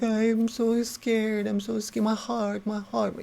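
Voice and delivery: high-pitched, drawn-out mock whimper; mock whining. Grown men crying on the hill, mock whining. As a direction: whine it high, imitating frightened cry-babies.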